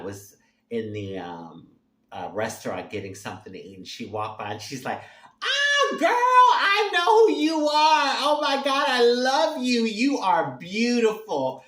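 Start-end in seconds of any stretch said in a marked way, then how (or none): 5.4-11.6 high, loud squeal of recognition; excited fan shout. Retold or imitated. Woman greeting her idol in a restaurant, excited shout. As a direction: gush at full volume like a thrilled fan.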